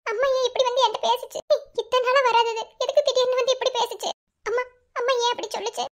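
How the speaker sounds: sobbing as she wonders why her mother came; crying. Acted. Alone after the dream, crying over her mother's words. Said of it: "எதுக்கு அழுகுற?".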